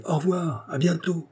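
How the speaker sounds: light high call, falling at the end; farewell call. A narrator's reading. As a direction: call farewell in a lifted, contained voice. Friend keeps calling goodbyes.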